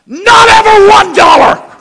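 high, slow, loud declaration; emphatic punchline. Real, not acted. Pastor revealing the money never came, slow high shout. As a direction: land every word of it.

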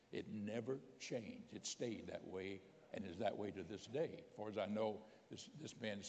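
normal talking voice

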